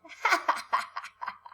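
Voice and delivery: breathy airy laugh on cue; laughing sound. Shown as a demonstration. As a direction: demo laugh for children. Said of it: "A ver, vamos a reír".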